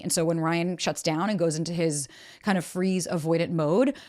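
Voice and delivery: ordinary talk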